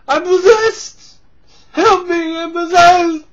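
high, loud frantic yelling; Martin Short panic. Retold or imitated. Martin Short style panic from Inner Space, high frantic yelling. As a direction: shriek it like a panicked comic actor. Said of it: "Martin Short in, uh, Inner Space".

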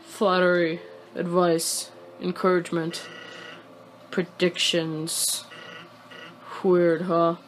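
low, laid-back character voice; game character voice. Acted. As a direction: read it low and level, in character.

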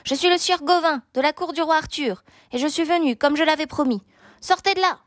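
high-pitched knightly challenge, projected; calling out. A narrator's reading. Gauvin announces himself to the hidden knight, calling out. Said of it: "cria-t-il".

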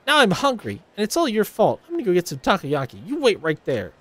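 high, huffy blame; sulky demand; bratty idol blaming Haruka; petulant rival idol. Acted. Voicing Ulala.

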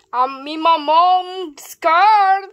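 slow loud start, then high cry for help; building panic shout. Pretend. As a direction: yell like the car got away. Toy in runaway car.

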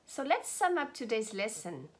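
ordinary talk

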